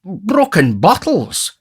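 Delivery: high, loud, drawn-out alarm; alarmed blurting. A narrator's reading. Beadle groping for a word in his alarm, high blurt. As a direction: blurt the wrong word slowly and loudly. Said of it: "in his alarm".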